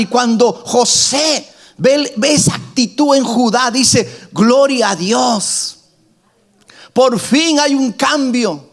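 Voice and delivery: high, impassioned sermon, slowing on quotes; fervent preaching. Acted. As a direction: preach it with full fire. Preaching at full force, high. (predicación ferviente y aguda)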